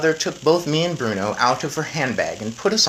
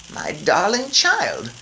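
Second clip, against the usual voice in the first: slow, wondering grandma; slowly; 6 semitones higher, much slower than usual. A narrator's reading. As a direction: draw it out in wonder. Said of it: "she said slowly".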